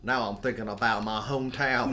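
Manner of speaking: loud, hammed-up Mississippi accent; exaggerated Southern accent. Host imagining Will Graham slipping home, mock Southern accent. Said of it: "like, slipped into those accents".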